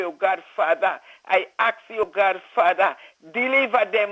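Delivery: ordinary talk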